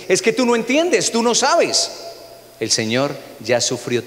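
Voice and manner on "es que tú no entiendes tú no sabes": higher, mock-defensive complaining voice; people's complaint. A pastor mimicking people who say he doesn't understand. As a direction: whine it like a defensive churchgoer. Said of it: "La gente siempre dice".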